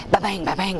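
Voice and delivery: bouncy ba-bang with a rising end; ball bouncing sound. Retold or imitated. Mom mimics son's basketball bouncing indoors, rising bounce sound. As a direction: copy the thud of a bouncing ball, lifting up.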